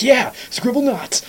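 high, slow excited cheer. Retold or imitated. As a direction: cheer it out, thrilled. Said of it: "I was like".

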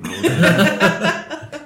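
very slow and high, laughing through it; slow laughing drawl. Shown as a demonstration. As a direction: stretch it out slowly while giggling. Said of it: "repeats line two ways".

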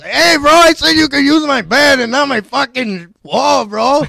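high, loud Chicano bro voice; Eddie Guerrero impression. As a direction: shout it as the buddy, pitched high. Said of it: "so he pops up and said".

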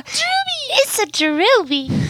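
high cooing fan voice; squeaky fangirl. Pretend. Fan squeal over favorite player.

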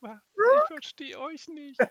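high, slow alien voice. Pretend. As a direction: speak it slowly like a baffled alien. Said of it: "eine Folge nur in Alienstimmen".

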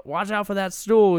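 put-on movie-quote Boston accent. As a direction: imitate a Boston barroom talker. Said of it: "I dig all the accents".

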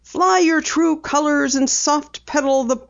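a girl reading a rude telegram aloud, lightly mocking. A narrator's reading. Daughter reads the angry letter to her father, mock indignant. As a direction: read it out with a hint of scorn. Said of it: "she read aloud".

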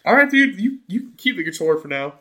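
backing off from angry stoners; nervous backing down. Retold or imitated. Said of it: "And I'm like".

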